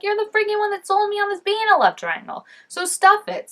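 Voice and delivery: high, loud mock rant; mock angry outburst. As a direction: vent at the show, half joking. Ranting at the show.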